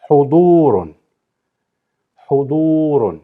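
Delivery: Hudurun with a long du; model pronunciation. Shown as a demonstration. Teacher modelling a word, clear and careful. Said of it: "the next word is".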